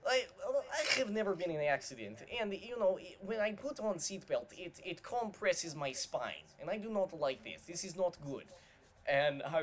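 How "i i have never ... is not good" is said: put-on higher, a light Russian accent; Russian driver impression. Quoting the Russian Uber driver to friends, light Russian accent. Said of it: "And he was like".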